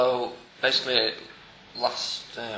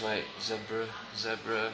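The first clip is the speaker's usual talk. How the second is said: low, softly murmured role-play; farmer scanning the field; 3 semitones lower, 10 dB quieter, slower than usual. Acted. Farmer checking animals for a missing cow, murmured low. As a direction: count them off quietly under your breath. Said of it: "the farmer goes".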